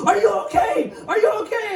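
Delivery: high shouted frantic worry; hammed-up panicked checking; frantic dad. Grandfather shaking the baby, frantic. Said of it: "holding him, shaking him".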